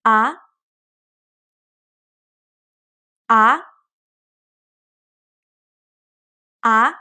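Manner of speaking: A sliding upward like a question; second tone. Shown as a demonstration. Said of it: "Please read after me".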